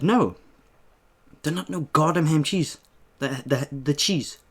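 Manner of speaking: heated comeback to the cashier insisting on plain cheese; retold angry retort. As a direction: push back hard at the cashier.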